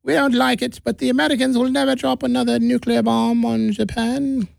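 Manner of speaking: hammed-up stand-up impression of a Japanese leader; mock Japanese official. Comedian to the crowd, mocking Japan's leaders after the first bomb, high hammed-up voice. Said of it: "I shouldn't do a Japanese accent. How about I just do".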